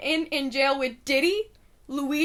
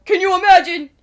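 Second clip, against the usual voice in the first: loud disbelief; 7 semitones higher, 14 dB louder than usual. Real, not acted. Mocking disbelief at the ball-drop question.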